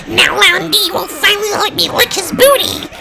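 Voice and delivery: very high, squealing creep voice; creepy guy. Retold or imitated. Creep gloating over the stolen mirror, squealing creep voice. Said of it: "that creepy guy from out of nowhere".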